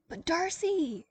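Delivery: high, loud frazzled cry; an exhausted boss cries out, frazzled; frazzled exclamation. A narrator's reading. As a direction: burst out in frazzled alarm. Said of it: "she exclaimed, frazzled".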